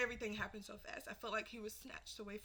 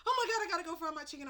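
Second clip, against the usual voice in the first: high, loud, very fast mock fluster; excited rush; 8 semitones higher, 8 dB louder, much faster than usual. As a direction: imitate an excited mother hurrying off. Retells mom's flustered excited rush.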